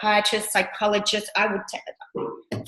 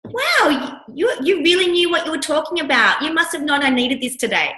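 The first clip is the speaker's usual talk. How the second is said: high, louder gushing praise; gushing client; 7 semitones higher, 4 dB louder than usual. Retold or imitated. Client praising coach, high gushing voice. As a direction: gush like an amazed client.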